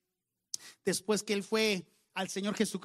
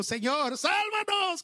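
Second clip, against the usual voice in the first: desperate plea; 9 semitones higher, much slower than usual. Retold or imitated. Disciples cry out to be saved.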